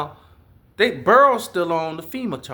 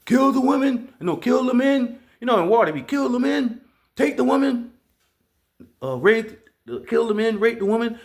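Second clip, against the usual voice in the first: higher, louder barked conqueror's orders; tornado as conqueror; 6 semitones higher, 4 dB louder than usual. Retold or imitated. Tornado voiced as a raiding warlord, barking orders.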